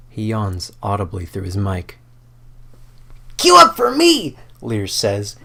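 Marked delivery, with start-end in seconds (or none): 3.4-4.4 much higher and louder, bright and eager; eager high character voice. Acted. Lear begging his friend to queue up, eager and high. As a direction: chirp it out like a keen young gamer.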